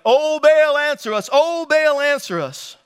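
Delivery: high, loud chant-like call to a god; shouted plea. A narrator's reading. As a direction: cry out for an answer, drawing out the name.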